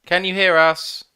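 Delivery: loud mic check, calling into the void. Real, not acted. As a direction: call out to check they hear.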